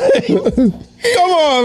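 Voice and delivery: laughing. Real, not acted. Laughing while urging the others on.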